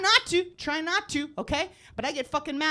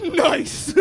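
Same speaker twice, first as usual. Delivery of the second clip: retold gloating; 2 semitones higher, 5 dB louder than usual. Comic watching rival in mirror, gleefully gloating. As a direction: savour his misfortune. Gloating at driver's near crash.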